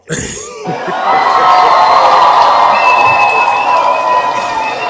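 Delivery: very high, loud burst of laughter; real explosive laugh. Real, not acted. Host cracks up at the caller's impression, loud laughing. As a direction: crack up helplessly, long and loud.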